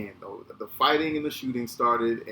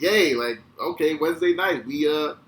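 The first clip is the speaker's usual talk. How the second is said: mock cheer, louder and higher; retold kid's happy little yay; 5 semitones higher, 7 dB louder than usual. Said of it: "but happy".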